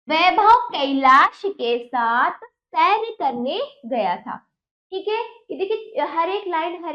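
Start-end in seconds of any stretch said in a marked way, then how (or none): 0.1-4.4 put-on reads each word slowly and clearly for children; slow lesson reading. Shown as a demonstration. Teacher reading the lesson text to children, slow and clear. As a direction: spell the sentence out slowly, louder.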